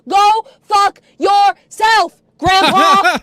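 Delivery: slow, loud, punched-out words; furious shout. Real, not acted. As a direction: shout each word separately, drawn out. Ranting at absent grandfather.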